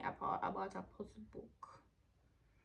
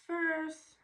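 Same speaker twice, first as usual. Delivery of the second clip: First. yelped high and loud; First. shrieked high and loud in disbelief; high shocked cry; 8 semitones higher, 9 dB louder than usual. Real, not acted. Shocked at hair loss.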